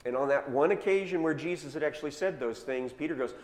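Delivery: ordinary delivery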